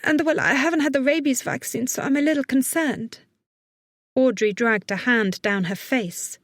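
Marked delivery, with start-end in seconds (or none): 0.0-3.2 put-on high, anxious young woman; trembling. A narrator's reading. Young woman admits she lacks rabies vaccine, trembling. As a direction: voice her nervous and higher-pitched.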